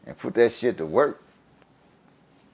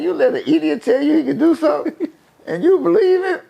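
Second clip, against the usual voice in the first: high-pitched, laughing disbelief; laughing at the absurdity; incredulous laughing; 3 dB louder than usual. Retold or imitated. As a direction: repeat it through laughter, voice riding up. Mocking a decision, laughing.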